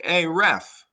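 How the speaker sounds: loud, high shout of "Hey, ref!"; yelled at the ref. Retold or imitated. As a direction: call out to the referee from the stands. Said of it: "they're yelling".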